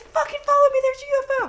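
high, loud urgent call; excited yell. Retold or imitated. Brother urging sister outside to see UFO, excited shouting. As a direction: urge her to come now, high and loud. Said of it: "he said".

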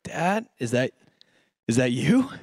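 low, hesitant question with a pause midway; retold uneasy check, rising at the end; wary. Child asking the silent figure in the car, warily.

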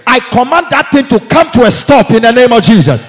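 loud commanding prayer; preaching at full force. Real, not acted.

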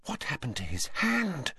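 hushed, high, shocked gasp. A narrator's reading. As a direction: ask softly, aghast. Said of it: "gasped Hermione".

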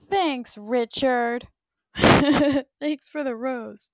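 slow, loud mocking gratitude with a laugh; drawn-out sarcastic thanks to the ex. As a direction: thank him sweetly while you mean the opposite.

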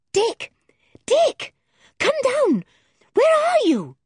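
put-on girl yelling through a locked door; calling out. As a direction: shout up the stairs to a trapped friend.

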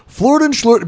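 high mock Swedish gibberish; bork-bork Swedish chef nonsense; terrible Swedish accent. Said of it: "be exactly the same".

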